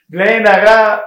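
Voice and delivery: ringing, emphatic blessing; proclaimed blessing. Real, not acted. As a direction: call the blessing out at full voice. Calling out a blessing.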